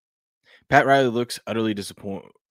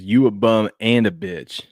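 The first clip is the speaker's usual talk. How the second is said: slow lip-reading; 5 dB louder, slower than usual. Retold or imitated. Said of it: "It says".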